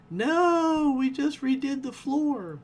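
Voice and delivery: quoting an alien who refuses to lose his new floor; indignant shout. Retold or imitated. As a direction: yell it like an outraged homeowner. Alien objecting to moon demolition.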